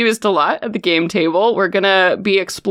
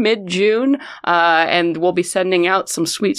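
ordinary talk, nothing marked

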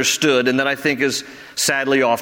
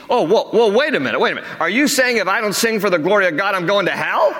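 higher, startled objection rising to disbelief; mock incredulous; 5 semitones higher, faster than usual. Listener questioning going to hell for not singing, mock incredulous. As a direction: cut in as a stunned listener.

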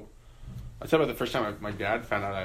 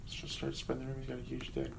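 mock whisper; 2 semitones higher, 12 dB quieter than usual. Mocking a PR department's scheming.

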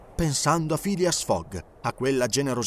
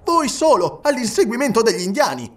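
much higher, louder disbelief; aghast at the plan; incredulous; 9 semitones higher, 6 dB louder than usual. Acted. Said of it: "esclamò Fix". (Fix incredulo, voce alta e concitata)

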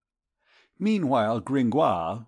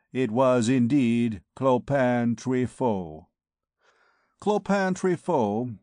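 ordinary delivery

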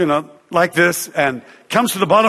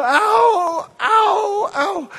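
pained cries; much slower than usual. Retold or imitated. Girl with the broken leg walks without crutches, mock pain cries. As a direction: imitate a girl yelping in pain on each step. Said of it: "And she go".

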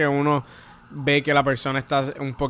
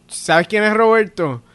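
put-on loud, drawn-out drunken question; loud slow drunk question; 6 semitones higher, 6 dB louder than usual. Drunk heckler loudly asks the comic about Roberto, drawn out. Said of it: "como que".

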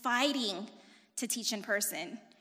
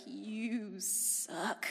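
softer, sulky you suck; sulky retort; 2 semitones lower, 6 dB quieter than usual. Retold or imitated. Daughter answering dad's request, softer and sulky. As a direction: grumble it under your breath. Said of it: "And I was like".

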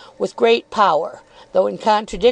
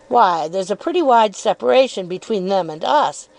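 plain reading voice

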